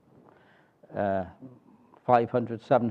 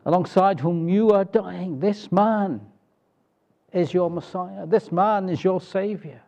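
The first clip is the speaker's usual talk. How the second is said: higher, urgent pleading preacher's voice; voice of the Holy Spirit; 5 semitones higher than usual. Acted. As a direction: plead with the dying thief to call out. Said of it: "the Spirit has been speaking to this man".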